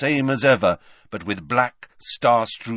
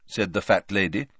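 plain reading voice

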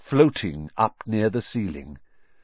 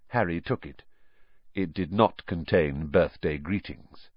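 ordinary delivery, nothing marked

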